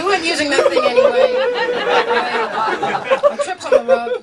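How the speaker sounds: high, loud jab; loud punchline. Pretend. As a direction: shout the punchline.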